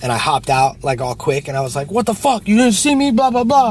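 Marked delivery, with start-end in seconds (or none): 1.9-3.7 high, louder mock outrage; hammed-up pissed-off driver; retold angry yell. Yelling at the driver who backed into him, retold angry yell. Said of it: "I was, like, all pissed off".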